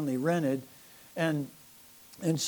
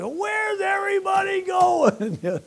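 much higher, louder and slow, stretched out in disbelief; mock bewildered shout; 14 semitones higher, 5 dB louder, slower than usual. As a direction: cry out at the empty room, hammed-up. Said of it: "I'm like".

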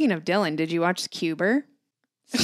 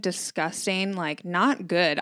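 ordinary delivery

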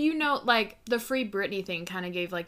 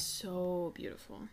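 slow dreamy whisper; 7 dB quieter, slower than usual. Real, not acted. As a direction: sigh it in a hush. Dreamy hushed agreement.

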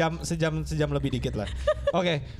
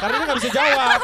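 talking through loud laughter; laughing; 8 semitones higher, 9 dB louder than usual. Real, not acted. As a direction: laugh out the words. Teasing friend, laughing.

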